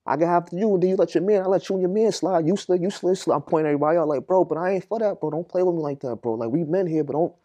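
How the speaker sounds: confronting men who cut the phone line, loud and furious; angry calling out. Retold or imitated. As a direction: tell them off for cutting the line. Retold calling out the line-cutters.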